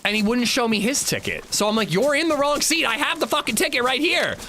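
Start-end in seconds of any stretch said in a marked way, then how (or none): 1.9-4.4 high-pitched, heated retold argument; acting out a fed-up complaint; exasperated confrontation. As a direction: insist indignantly, voice pushed way up. Retold argument over seats.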